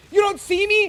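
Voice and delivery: put-on kid's voice, very high and loud; voicing his son. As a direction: squeal it like an excited little kid who can't believe dad misses him. Son voiced in game story.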